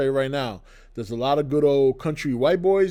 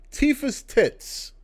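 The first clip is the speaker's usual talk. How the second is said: "Tifa's tits" drawn out slowly and pitched much higher; a cheeky, drawn-out reveal of something he shouldn't say; coy slow delivery; 10 semitones higher, much slower than usual. Real, not acted. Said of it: "so I'm gonna say it this way".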